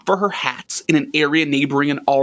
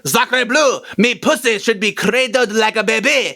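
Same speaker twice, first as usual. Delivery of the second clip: hammed-up French accent, pitched higher, laughing through it; mock French accent; 4 semitones higher than usual. Chanel fed up with riding side saddle, in a mock French accent. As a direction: play a cartoon Frenchwoman in an overdone French accent. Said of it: "I can't do a French accent".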